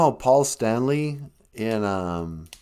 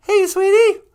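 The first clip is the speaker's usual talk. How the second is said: high fluttery call of sweetie; a sudden high sweet call; 9 dB louder than usual. Acted. Host calls out to assistant.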